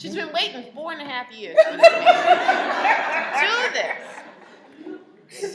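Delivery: loud, slow, laughing through it; amused laughing disbelief. Real, not acted. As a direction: laugh as you tell it. Laughing at wife waiting.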